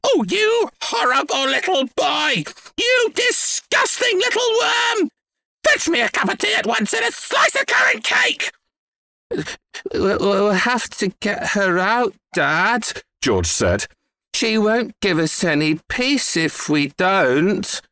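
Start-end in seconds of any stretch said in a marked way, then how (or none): 0.0-8.5 high screeching insults and orders; grandma's angry yell. A narrator's reading. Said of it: "Grandma yelled". 9.3-13.0 put-on slow, higher, stammering boy; anxious young son; hesitant plea. A narrator's reading. Boy pleads with father. 14.3-17.8 higher, slow, drawn-out appeal; earnest young son making his case; pleading boy. A narrator's reading.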